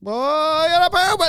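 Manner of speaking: high, loud imitation of a desperate child; urgent kid. Three-year-old announcing a sudden toilet emergency, urgent high kid voice. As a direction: wail it as a mock toddler emergency. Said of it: "it was just, like, emergency".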